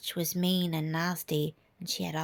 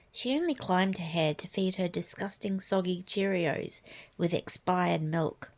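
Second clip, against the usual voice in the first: ordinary delivery, nothing marked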